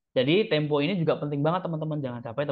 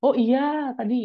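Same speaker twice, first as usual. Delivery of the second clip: loud, much higher-pitched exclamation; 11 semitones higher, 5 dB louder than usual. Shown as a demonstration. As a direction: send the pitch right up, loudly. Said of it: "nadanya lebih tinggi".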